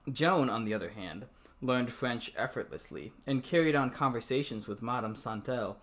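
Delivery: even novel reading voice; story narration. A narrator's reading. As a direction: read the book aloud, steady. Reads novel aloud.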